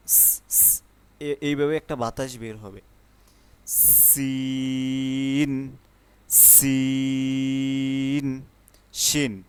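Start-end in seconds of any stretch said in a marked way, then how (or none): 0.1-0.8 voiceless স with air rushing out; airy hiss. Shown as a demonstration. 3.7-8.4 very slow সিন with a long hiss; drawn-out sin. Shown as a demonstration.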